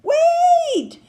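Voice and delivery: high, loud cry of wait; shout. Acted. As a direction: call out to stop the dragon. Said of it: "shouted Elizabeth".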